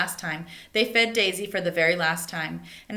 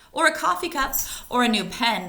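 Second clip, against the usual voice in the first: eager suggestion; 5 semitones higher, 5 dB louder than usual. Acted. Child voiced excitedly in story.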